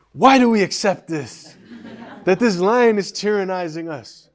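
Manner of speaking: loud, high mock outcry; revolutionary protest. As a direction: protest like a hammed-up rebel leader. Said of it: "Now he becomes a revolutionary".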